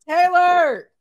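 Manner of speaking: high, loud playful shout; real shout; shouted name. Real, not acted. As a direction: call her out. Friend teases her.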